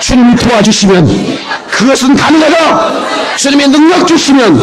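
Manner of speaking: high-pitched, impassioned chant shout; full-out revival chanting, held high; bigger rallying cry. Real, not acted. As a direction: lead the congregation in a soaring declaration. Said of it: "더 크게".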